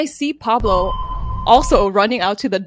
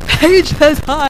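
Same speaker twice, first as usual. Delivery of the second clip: drawn-out, high, loud mock yell; slow, exaggerated impression of yelling; imitated scream; 5 semitones higher, 5 dB louder, much slower than usual. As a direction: holler it slowly so it carries far away. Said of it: "you can hear Melly screaming".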